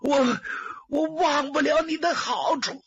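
high, sobbing thanks; tearful gratitude, voice breaking high; crying. Acted. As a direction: thank him through tears. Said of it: "别哭，别哭".

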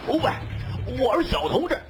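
high-pitched panicked yell; bellowing. A narrator's reading. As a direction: holler up high at the pilot in a fright. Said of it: "就一声大吼". (高声大吼，又急又怕)

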